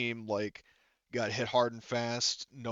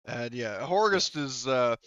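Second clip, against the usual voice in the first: normal talking voice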